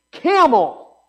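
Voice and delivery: camel, hit high and loud as a one-word point; stressed camel. Acted. Preacher drills the congregation on the image, punching camel. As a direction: land "camel" hard and high before moving on.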